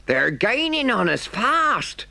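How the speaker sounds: high, slow alarmed cry. Acted. Driver warning Toad the pursuers are gaining, alarmed high cry. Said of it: "cried the engine driver".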